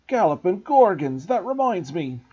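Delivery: loud, booming exclamation; a big giant's sudden outburst; Hagrid exclaiming. Acted. Hagrid remembering something, a booming outburst.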